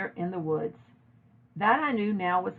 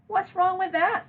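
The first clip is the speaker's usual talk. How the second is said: high retort; 12 semitones higher, 4 dB louder than usual. A narrator's reading. Girl defends friends.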